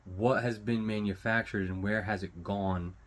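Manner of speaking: ordinary talk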